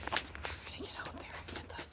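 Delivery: hushed whisper, barely any voice. Real, not acted. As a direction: whisper it so the neighbors can't hear. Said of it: "Why are you whispering like that?".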